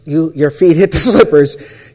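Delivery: loud, breaking into a laugh; laughing mid-line. Real, not acted. Speaker retelling the slipper line, laughing. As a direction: laugh through the word slippers.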